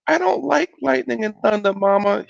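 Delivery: put-on high-pitched child complaining to Mama; frightened little boy. A narrator's reading. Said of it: "he said".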